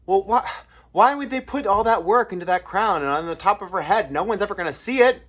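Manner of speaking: loud, high-pitched acted protest; incredulous questioner. Someone questioning Oscar Hammerstein about the crown, loud incredulous objection.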